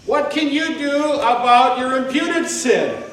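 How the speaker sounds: high, loud preacher's challenge; fervent preaching. Acted. Preacher challenging the congregation about sin, loud and high. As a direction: drive the question home hard.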